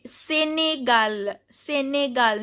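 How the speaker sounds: "Senegal" said as sé-né-gal; pronounced final L. Shown as a demonstration. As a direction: say "Senegal" carefully, sounding the last L. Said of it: "L is pronounced".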